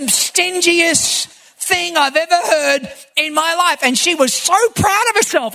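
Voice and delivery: ordinary delivery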